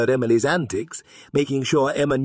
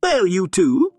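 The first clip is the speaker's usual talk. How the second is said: stammered; 6 semitones higher, 4 dB louder than usual. A narrator's reading. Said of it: "she stammered".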